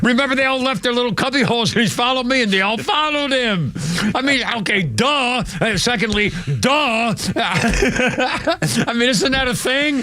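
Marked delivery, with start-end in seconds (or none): 4.2-10.0 words bubbling with laughter; laughing. Real, not acted. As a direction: laugh while asking. Laughing at the robot story.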